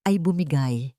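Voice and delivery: ordinary delivery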